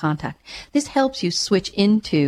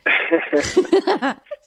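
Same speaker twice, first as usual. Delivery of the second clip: warm rising laugh; 8 semitones higher than usual. Real, not acted. Laughs before returning to caller.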